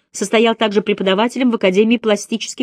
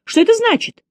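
a much higher, alarmed cry; 13 semitones higher than usual. A narrator's reading. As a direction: cry out in sudden fear. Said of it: "крикнула незнакомка". (испуганный возглас, намного выше)

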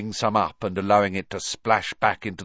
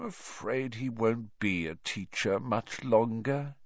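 slow, soft fake sadness; mock sorrow; 4 semitones higher, 5 dB quieter than usual. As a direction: put on a sorrowful act. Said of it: "in a tone of mock sorrow".

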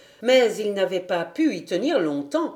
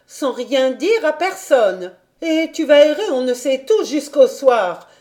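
higher, forceful governess reprimand; stern, solemn; 5 semitones higher, 4 dB louder than usual. A narrator's reading. Governess reprimands Heidi at table, stern and solemn. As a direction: scold with solemn severity, weighing key words. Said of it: "sévère et d'un ton solennel".

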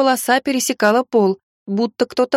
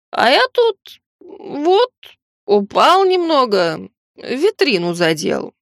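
put-on slurred drunk; 3 semitones higher, much slower than usual. Drunk butcher keeps talking.

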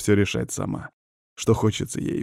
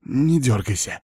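higher, mildly hissed warning; hissing; 4 semitones higher than usual. Acted. Witch snaps at her fidgeting patient, mild hiss. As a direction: hiss it lightly like a wild cat. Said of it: "прошипела она дикой кошкой".

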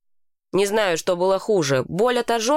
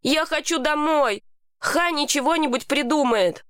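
high-pitched, insistent exclamation; restrained narrated outcry; mild yell; 7 semitones higher than usual. A narrator's reading. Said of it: "заорал я".

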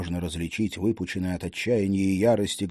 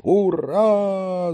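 distant army cheer; 9 semitones higher, 3 dB louder than usual. Acted. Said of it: "закричали солдаты".

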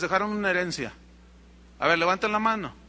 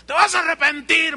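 higher, louder warning; threat; 8 semitones higher, 7 dB louder than usual. Retold or imitated. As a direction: threaten him as he leaves. Amenaza del hombre rico. (amenaza fuerte)

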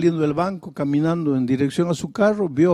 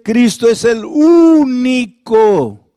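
Cristo es el único drawn out slowly and louder, each word hammered; slow emphatic stress; 4 semitones higher, 11 dB louder, much slower than usual. Acted. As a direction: stretch out "Cristo es el único" and lean on it loudly. Vaaz doruğunda vurgu.

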